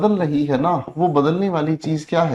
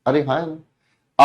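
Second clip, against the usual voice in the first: normal talking voice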